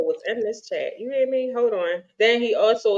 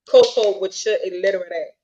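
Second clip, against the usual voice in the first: usual speaking voice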